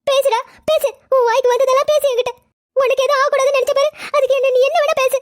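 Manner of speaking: high, wounded crying voice; hurt mother's tearful outburst, pitched high; tearful reproach. Acted. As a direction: dare your son to keep talking, through tears. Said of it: "எதுக்குடி நீ அழுவுற".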